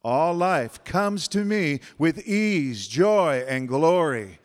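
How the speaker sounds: slow, loud declaration; emphatic affirmation. Shown as a demonstration. Speaker leading the room in an affirmation, slow loud proclaiming. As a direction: proclaim it slowly with full voice. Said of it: "Say it again".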